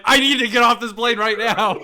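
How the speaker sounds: loud, high, hammed-up panic; hysterical mock scream. Passenger demanding off the plane in first class, mock hysterical scream. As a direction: scream it hysterically, half laughing. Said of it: "hysterical in the middle".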